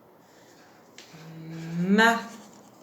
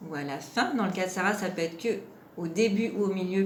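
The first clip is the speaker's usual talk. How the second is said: normal talking voice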